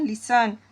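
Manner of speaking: लिसन said with no t sound; listen, silent t. Shown as a demonstration. Teacher showing students लिसन with a silent t. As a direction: say लिसन as lisən, the t dropped. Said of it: "ये जो टी होता है ये silent है".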